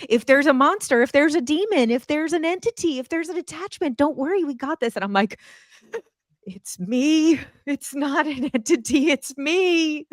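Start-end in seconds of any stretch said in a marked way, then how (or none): 0.1-4.9 high-pitched, building list of imitated promises; mock eager reassurance. Speaker imitating her healer friend reassuring her, mock eagerness. 6.4-10.1 high, whiny retold cry breaking into laughter; mock distraught wail. Speaker replaying her protest to the healer, mock wailing. Acts out distraught confession during meltdown.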